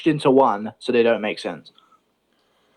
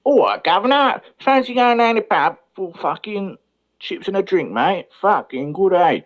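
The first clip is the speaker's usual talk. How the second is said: high, drawn-out Cockney geezer; hammed-up Cockney, pitched well up; 7 semitones higher than usual. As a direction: invite a mate out in broad Cockney. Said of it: "It's literally just a Cockney voice".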